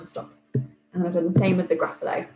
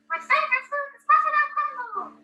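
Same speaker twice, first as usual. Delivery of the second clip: high squeaky mouse voice on a playback; recorded squeaky mouse coming from the speakers; mouse voice played back; faster than usual. Teacher listens back to her take, tinny squeaky mouse voice. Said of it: "squeaky little mouse voice".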